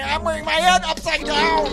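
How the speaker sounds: high, loud blurt; mock flustered outburst. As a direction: give up and blurt it out. Fumbled joke in blooper bit.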